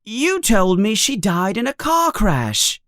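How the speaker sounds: put-on a boy asking, eager to know; young Harry. A narrator's reading. Harry voiced high.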